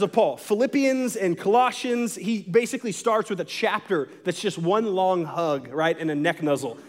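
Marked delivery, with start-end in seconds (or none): none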